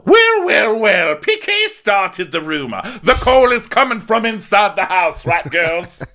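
very high, loud hammed-up impression; mock accusation. Accusing P K of starting the rumor, high loud mock voice. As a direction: crow it in a big put-on high voice.

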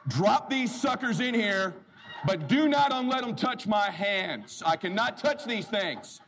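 slow, careful warning; slow emphatic instruction; deliberate instruction. Retold or imitated. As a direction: spell it out slowly. Insisting not to touch policies.

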